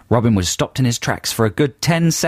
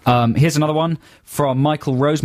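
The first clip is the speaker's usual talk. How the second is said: usual speaking voice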